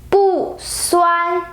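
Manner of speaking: "bu" falls from high, then "suan" stays high and level; unchanged fourth tone. Shown as a demonstration. Teacher showing bu before first tone: falling "bu", high level "suan". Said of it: "bu stays the same when it goes with the".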